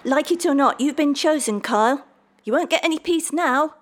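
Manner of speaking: loud, high-pitched shouted warning; calling out. A narrator's reading. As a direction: keep calling after someone who is getting away. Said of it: "called the little man".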